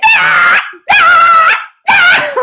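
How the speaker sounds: loud, very high imitated car beeper; repeated piercing alarm cries. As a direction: shriek like a blaring alarm. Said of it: "the car's like".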